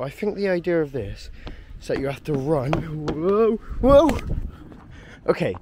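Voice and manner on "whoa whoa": startled whoa. Real, not acted. Losing footing on a ride.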